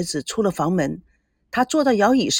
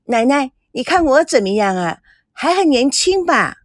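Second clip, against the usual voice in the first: high, sugary, flirtatious woman; coquettish simper; 6 semitones higher, 4 dB louder than usual.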